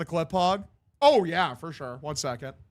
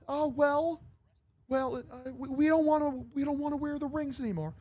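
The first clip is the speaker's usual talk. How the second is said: high, hesitant young-singer impression; 9 semitones higher, 3 dB quieter than usual. Band objects to [name] about rings, high hesitant voice. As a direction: object timidly in a high, put-on voice. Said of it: "the fucking Jonas Brothers say something".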